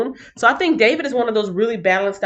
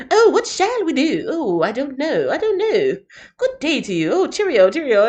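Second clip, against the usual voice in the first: prim cartoon gopher in a posh English accent; bouncy, overly polite English accent, pitch hopping higher; 6 semitones higher than usual. Teacher imitates the Goofy Gophers for her class, posh English accent. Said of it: "they're just very polite".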